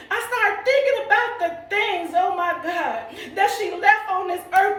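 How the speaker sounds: rejoicing preacher with bursts of laughter; preaching. Acted. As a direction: testify with rising joy, half-shouting. Preaching about praying grandmother.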